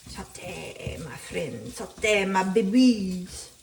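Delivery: hammed-up French accent, crooning to the pan; sing-song fake French to the paneer; mock French. Cooking vlogger coos at her frying paneer in mock French. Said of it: "I don't know why I'm French".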